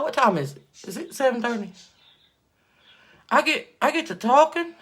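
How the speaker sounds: usual speaking voice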